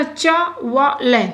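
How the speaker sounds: चा व ल sounded out slowly in separate beats; syllable by syllable. Shown as a demonstration. Teacher showing how a reader spells out a word. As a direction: break चा व ल into its parts. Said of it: "पढ़ते पढ़ते ऐसे आएगा".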